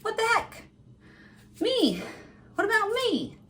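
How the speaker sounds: high, put-on hurt voice; whiny heart voice. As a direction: play the heart whimpering for attention. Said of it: "your heart is like".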